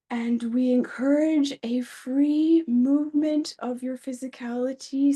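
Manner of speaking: slow, measured phrases with long pauses; channeled guided meditation. Pretend. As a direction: lead listeners into the breath as a channeled being. Share a meditation.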